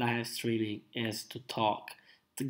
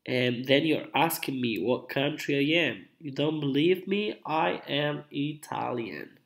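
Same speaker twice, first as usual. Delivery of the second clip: ordinary talk, nothing marked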